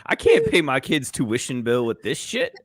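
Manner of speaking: ordinary talk, nothing marked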